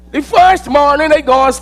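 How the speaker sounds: ordinary delivery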